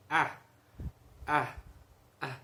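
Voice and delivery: A with the tongue pushed forward. Shown as a demonstration. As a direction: say A, tongue further out. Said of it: "você vai ler o A com a língua mais pra fora".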